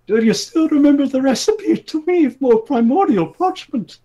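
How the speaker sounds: son's high urgent plea; high character voice. A narrator's reading. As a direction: plead up high and loud. Son pleading question to father.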